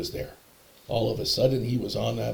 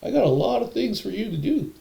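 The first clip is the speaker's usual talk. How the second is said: high, loud, easygoing send-off; casual God voice pitched way up; 14 semitones higher, 5 dB louder than usual. Retold or imitated. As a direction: wave them off in a high, raised voice. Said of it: "he, he goes".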